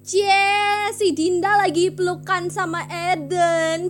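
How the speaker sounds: loud, high, slow sing-out tease; teasing yell. Acted. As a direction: holler it across a classroom to embarrass them. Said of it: "It's like this".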